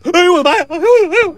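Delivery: high frightened yelp; terrified shriek. Acted. Jin shrieks in terror as the corpse sits up. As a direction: shriek as if the dead man rose. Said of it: "金二胖子吓得黄金入库了".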